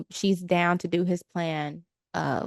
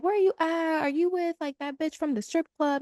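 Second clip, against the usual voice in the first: retold yelling; 9 semitones higher than usual. Angry girlfriend on the phone demanding where he is, mock yell. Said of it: "yelling at him and asking him".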